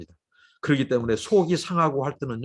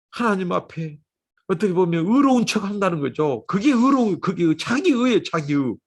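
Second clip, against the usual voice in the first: ordinary delivery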